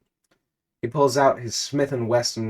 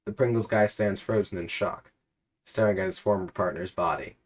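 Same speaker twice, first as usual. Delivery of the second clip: ordinary delivery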